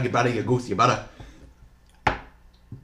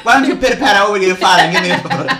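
fast, louder Italian-ish patter with a laugh; mock Italian accent; 6 semitones higher, 6 dB louder, much faster than usual. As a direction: rattle it off like a comic Italian. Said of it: "Now it's Italian".

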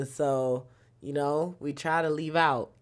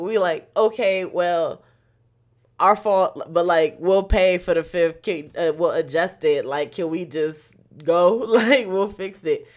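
pleading with the driver; 3 semitones higher, 7 dB louder than usual. Retold or imitated. Friends begging the Lyft driver to just go, loud and pleading. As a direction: talk him down, loud and desperate. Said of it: "We's like".

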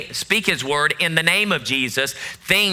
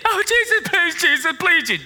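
high pleading mock whine, fading down; mock begging; 11 semitones higher than usual. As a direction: parody a believer begging Jesus, voice very high. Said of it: "you're begging doesn't get it".

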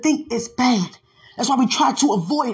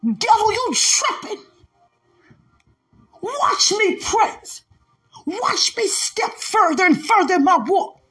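preaching at full force; 7 semitones higher than usual. Acted. Preacher to the congregation, defying Satan, in full-force preaching. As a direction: shout it out over the music, taunting the devil.

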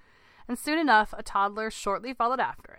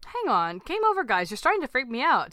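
raised, high protest; yelling; 5 semitones higher, 5 dB louder than usual. A narrator's reading. Dad telling the family the game is over, yelling. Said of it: "Terry yelled".

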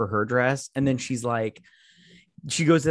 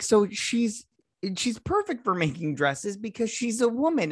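sarcastic mock voice; 9 semitones higher than usual. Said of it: "I'm all like".

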